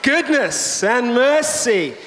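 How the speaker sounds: slow, proclaimed scripture reading; congregational reading. Real, not acted. Pastor leading the church in reading Psalm 23, slow and proclaimed. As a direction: read it aloud together, slow and declared. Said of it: "read this all together in a loud voice".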